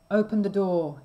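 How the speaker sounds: loud shout at the castle door. A narrator's reading. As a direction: bellow it out to be let in. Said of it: "he shouted".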